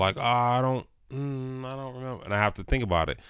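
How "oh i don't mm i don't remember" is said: mock-hesitant, searching the memory; unsure recalling. Acting out own hazy memory when asked, hesitant.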